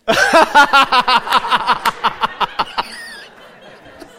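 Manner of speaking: laughter. Real, not acted. Child disrupts, congregation laughs.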